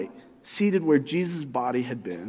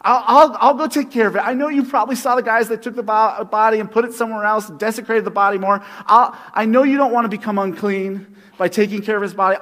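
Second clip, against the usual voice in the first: louder, higher rushed pleading; Mary pleading with the gardener; frantic Mary; 5 semitones higher, 6 dB louder than usual. Retold or imitated. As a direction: talk fast as if desperate to find the body. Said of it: "She thinks he's a gardener".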